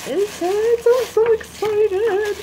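very high, slow, squealing delight; excited squeal. Real, not acted. Opening her friend's surprise gift, squealing with excitement.

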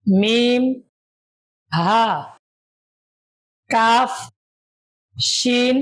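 slow letter-by-letter drill; reciting letters. Shown as a demonstration. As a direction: call out each letter for students to repeat. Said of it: "আমার সাথে সাথে আপনারা বলুন". (ধীরে ধীরে হরফ উচ্চারণ)

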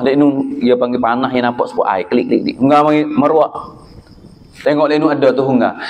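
ordinary talk, nothing marked

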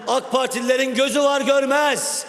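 rousing accusation. Real, not acted. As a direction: build to a ringing closing attack on rivals. Opposition MP's closing attack on rivals. (yükselen sesle suçlayıcı meydan hitabı)

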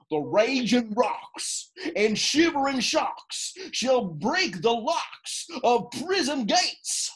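slow, grand ranting declamation; lofty tyrant rant. Acted. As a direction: declaim it like a stage tyrant, slowly. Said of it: "Oh, this was lofty".

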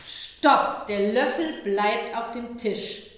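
lautes Stopp, dann langsam und bestimmt; „Stopp!“ laut setzen, „Der Löffel bleibt auf dem Tisch“ langsam und fest; klares Stopp. Shown as a demonstration. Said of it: "ich habe ja ziemlich klar Stopp gesagt". (Mutter setzt dem Kind eine klare Grenze, laut und langsam)

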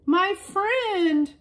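high, joyful cry of reunion; gleeful exclaim. A narrator's reading. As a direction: exclaim with glee. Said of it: "they each exclaimed with glee".

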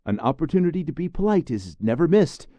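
high, loud mock-grand pronouncement; announcing with a smile. Khan announces a motto to the boys, high and grand. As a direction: declare it like a proud little gentleman. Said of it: "announces with a smile".

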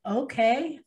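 high, rising, unsure reply; puzzled okay. Retold or imitated. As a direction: humor a stranger warily. Retold wary reply to a stranger.

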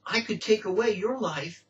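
ordinary delivery

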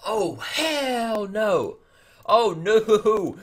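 loud, high, drawn-out protest; outraged dismay. Real, not acted. Gamer seeing his team wiped out, outraged. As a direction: wail it out, long and indignant.

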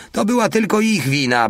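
very high, whining self-defence; defensive protest. A narrator's reading. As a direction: protest in a high voice, shifting blame. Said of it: "bronił się Porta". (wysoki ton obronnego protestu)

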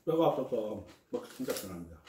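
chewing while talking; speaking around food; mouth full. Real, not acted. Seen 1: Lips closed, cheeks bulging mid-sentence; he is eating at the table.

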